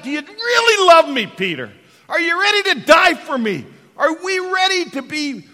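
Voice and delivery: loud, high, pressing preacher's challenge; preaching at full force. Acted. Jesus pressing Peter on love, preached at full force.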